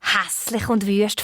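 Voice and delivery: ordinary delivery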